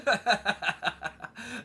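amused, sustained laugh. Real, not acted. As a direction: burst of real laughter. Reacting to monocle joke.

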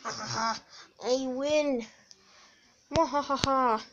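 sneering panto villain. Pretend. Villain wins the toy fight.

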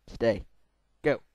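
normal talking voice

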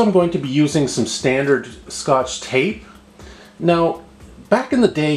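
normal talking voice

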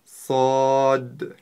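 Сад with a hard whistling s and a clipped final д; Arabic letter sad. Shown as a demonstration. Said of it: "Послушайте внимательно".

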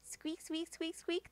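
soft high squeaks; squeaky rubber duck. Pretend.

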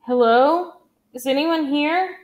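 loud, high call into the dark. A narrator's reading. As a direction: call out to anyone hiding. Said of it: "he called out in Japanese".

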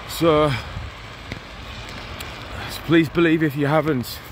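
ordinary delivery

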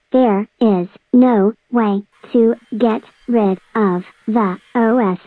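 slow, with a pause after every word; emphatic, word by word. Acted. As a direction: spell it out slowly, each word standing alone. Repeats his claim word by word.

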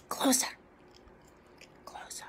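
breathy whispered closer. Pretend. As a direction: lean in and whisper it. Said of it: "Come close".

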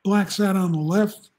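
usual speaking voice

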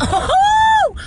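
very high, held "oh"; shrieky little hoot; excited whoop. Real, not acted. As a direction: whoop with delight. Excited pulling in, no line.

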